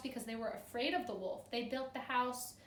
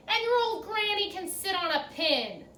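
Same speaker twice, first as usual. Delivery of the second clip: higher, louder taunting yell; 8 semitones higher, 10 dB louder than usual. Acted. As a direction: fling the insult at him. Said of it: "the third little pig yelled".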